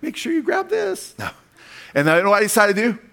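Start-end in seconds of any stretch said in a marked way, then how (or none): 0.0-1.1 much higher, slow mock wife reminder; a high, slow playful take on his wife; imitating Lisa. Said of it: "I think Lisa was like".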